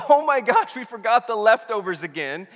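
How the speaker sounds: loud, high-pitched mock panic; mock freak-out. Disciples in the boat without bread, mock freak-out. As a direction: burst out in exaggerated alarm. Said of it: "they're freaking out about this".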